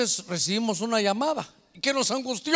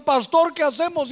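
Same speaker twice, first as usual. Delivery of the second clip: anxious plea; 8 semitones higher, 4 dB louder than usual. Retold or imitated. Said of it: "Y nos llamaron".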